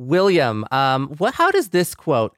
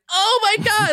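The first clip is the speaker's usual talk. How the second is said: "Oh my God" shot up high in retold amazement; amazed gasp; 3 dB louder than usual. Fan recalling the new transporters, retold amazed outburst. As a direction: relive the jaw-drop moment. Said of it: "I was like".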